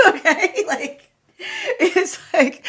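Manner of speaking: helpless real laughter through the words; high, giggling, barely getting words out; laughing. Real, not acted. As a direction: crack up while talking. Cracking up mid-reading.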